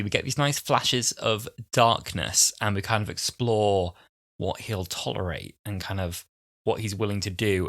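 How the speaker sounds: normal talking voice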